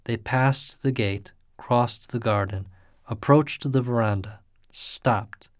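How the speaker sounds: usual speaking voice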